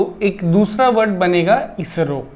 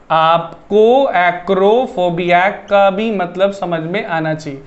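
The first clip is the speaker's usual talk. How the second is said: slow "आपको acrophobia" word by word; slow, deliberate repeat; 2 semitones lower, slower than usual. Shown as a demonstration. Teacher making sure students catch the related word, slow and deliberate. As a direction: spell it out slowly for the class.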